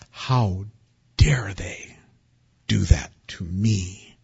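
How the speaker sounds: hushed, slow, half-whispered grievance; whispered indignation. Retold or imitated. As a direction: mutter it like a private grudge. Muttered inner indignant thought.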